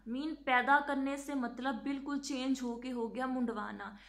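ordinary talk

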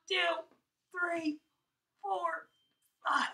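high, loud voice squeezing hard; straining effort. Real, not acted. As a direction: push the words out while tensing every muscle. Squeezing legs during yoga demo.

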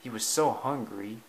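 ordinary delivery, nothing marked

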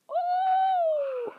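long, very high held "ooh"; sustained falsetto hoot; high-pitched ooh. Pretend. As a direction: squeal one steady high note. Taunting a beaten enemy.

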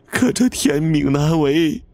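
high, tearful lament to a dead wife; crying while speaking. A narrator's reading. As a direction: grieve aloud through tears. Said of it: "一边哭一边说".

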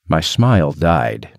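usual speaking voice

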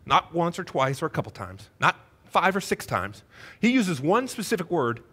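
ordinary talk, nothing marked

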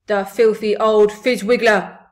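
loud giant's outburst; outraged shout. A narrator's reading. BFG curses the orphanage woman, outraged shout. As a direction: shout the insult in character. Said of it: "shouted the B F G".

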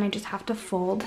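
ordinary delivery

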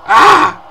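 very high, loud yell; excited scream. Real, not acted. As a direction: scream in the heat of the game. Scream before hitting target.

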